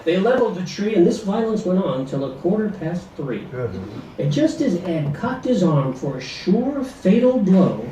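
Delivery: ordinary delivery, nothing marked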